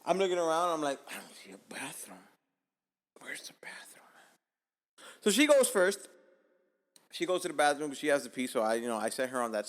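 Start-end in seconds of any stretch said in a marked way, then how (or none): 1.1-5.0 retold hushed, anxious whisper; urgent whisper. Man whispering to himself as he hunts for a toilet, urgent whisper. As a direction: whisper it as if secretly desperate.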